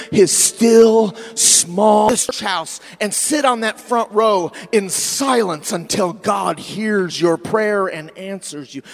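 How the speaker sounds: loud, stretched-out preacher delivery; impassioned preaching. Acted. Preacher urging church to slow down and listen, impassioned. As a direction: preach it hard, drawing out key words.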